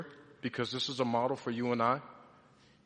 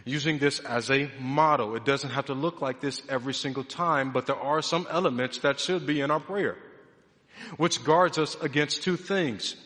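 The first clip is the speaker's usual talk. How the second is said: normal talking voice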